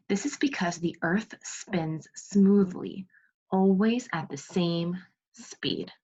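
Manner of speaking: slow, clear read-aloud for second graders; reading aloud to kids. Acted. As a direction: read the book slowly to the class, word by word. Teacher reads book to class.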